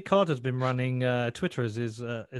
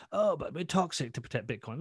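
higher-pitched mock excuse; put-on voice, making fun of the excuse; mocking imitation; 5 semitones higher than usual. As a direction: mimic a self-justifying troll. Mimicking online trolls' excuse.

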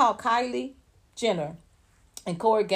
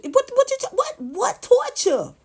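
incredulous; 13 semitones higher, slower than usual. Real, not acted. Incredulous repeated question.

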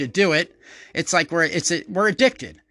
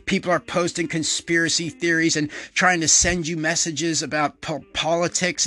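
ordinary delivery

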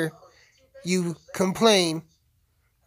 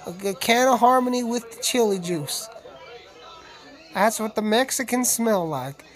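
urban cowboy voice; 5 semitones higher than usual. Shown as a demonstration. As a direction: show off the new cowboy voice. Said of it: "my new urban cowboy voice".

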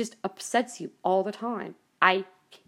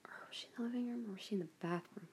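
whispered aside; 15 dB quieter, faster than usual. Real, not acted. Muttered aside, unsure where Mom was.

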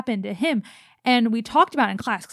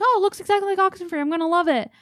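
excited fan voice; 8 semitones higher, much faster than usual. Retold or imitated. Fans' excited expectation quoted.

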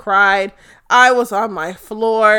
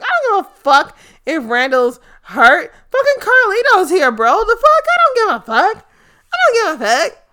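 much higher, squealing with glee; giddy don't-care; 10 semitones higher, 5 dB louder than usual. Retold or imitated. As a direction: shriek it like nothing else matters. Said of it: "I was like".

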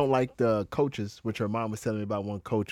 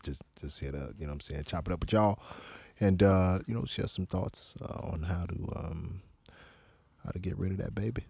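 low, soft, laid-back smooth voice; easy, deep and unhurried; 6 semitones lower, 4 dB quieter than usual. Real, not acted. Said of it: "This motherfucker sound like".

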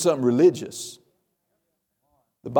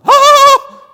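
sudden very high, loud scared shriek; panicked shriek; 14 dB louder than usual. Pretend. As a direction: jump at bad news on the phone. Mock fright at a phone call.

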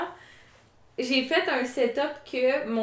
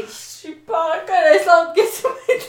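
laughing; 5 semitones higher, 7 dB louder, slower than usual. Real, not acted. Laughing after playful hit.

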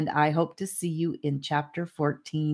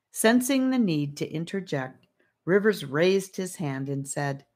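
usual speaking voice

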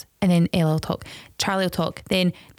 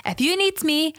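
higher-pitched mum laying down the law; mum's firm call, pitched up; 6 semitones higher than usual. Acted. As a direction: lift it into a telling-off mum. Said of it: "turn it to my mum and go".

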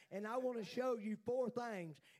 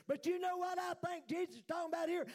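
ordinary talk, nothing marked

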